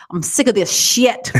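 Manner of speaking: drawn-out grumbling rant, hammed up lightly; mock rant. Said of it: "he'll go".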